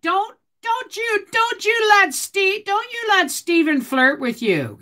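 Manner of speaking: wag a finger at the ladies in fun; teasing mock-stern warning. Teasing warning to viewers about Steven.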